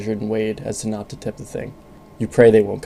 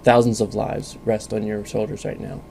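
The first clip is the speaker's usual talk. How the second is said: ordinary delivery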